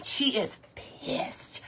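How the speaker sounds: slow stage whisper. Real, not acted. Telling viewers about the angry hen, whispered. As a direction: whisper it like a secret, slowly. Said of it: "unvoiced whisper".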